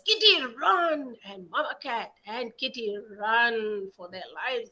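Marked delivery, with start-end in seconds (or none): 0.0-1.1 loud, high cry to run; urgent shout. A narrator's reading. Mother cat warns kitty of the dog, urgent shout. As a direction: yell at the kitten to flee. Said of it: "Mama cat says".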